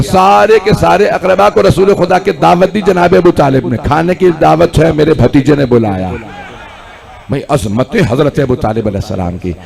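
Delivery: normal talking voice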